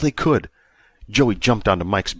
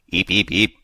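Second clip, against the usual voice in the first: shouted creature squeak. A narrator's reading. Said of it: "Dexter shouted".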